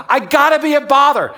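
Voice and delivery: loud, high acted outburst; exasperated protest. Preacher voices a doubting member to himself, exasperated and loud. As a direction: burst out as the frustrated believer.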